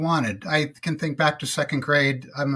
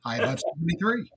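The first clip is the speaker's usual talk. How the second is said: put-on radio announcer voice; 3 semitones higher than usual. Shown as a demonstration. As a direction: launch big like a morning radio host, then ease into the forecast. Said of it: "Can you give us the radio voice".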